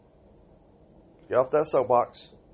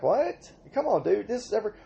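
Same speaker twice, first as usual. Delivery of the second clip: high pitched disbelieving what come on dude; mock exasperated cry at the umpire; incredulous protest; 8 semitones higher than usual. Player protesting the umpire, high incredulous disbelief. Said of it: "And I'm like".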